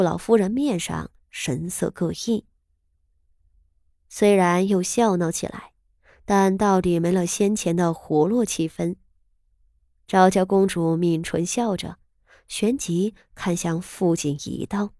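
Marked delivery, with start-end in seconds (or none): none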